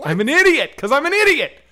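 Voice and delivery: loud, high self-mocking outburst; exasperated admission. Real, not acted. Storyteller owning up to his dumb decision, exasperated. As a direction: shout it in exasperation at yourself.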